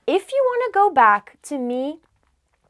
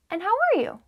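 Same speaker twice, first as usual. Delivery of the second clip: "And how are you" pitched higher as a question; rising question intonation; 8 semitones higher, much faster than usual. Shown as a demonstration. Teacher demonstrates an English question, voice going up. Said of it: "I was going up".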